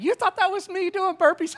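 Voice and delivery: high-pitched imitation of an amused woman; high, teasing question; mock incredulous. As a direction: quote her in a high, disbelieving voice. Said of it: "She's like".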